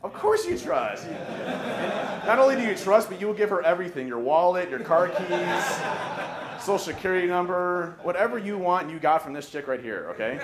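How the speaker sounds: high, slow, laughing the words out through giggles; can't stop laughing at how much you'd hand over. Real, not acted. Speaker to audience, cracking up at their gullibility, laughing. Laughing about trusting the pictured girl.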